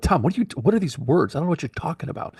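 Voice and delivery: baffled, as if none of these words make sense; baffled protest. Retold or imitated. Confused by Tom's strange words.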